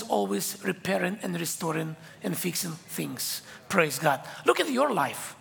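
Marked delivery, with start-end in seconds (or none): none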